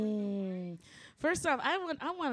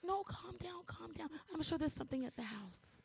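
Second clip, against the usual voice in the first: imitating a calm child; 7 semitones higher than usual. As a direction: play the kid talking his mum down, gently. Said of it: "he looked at me like".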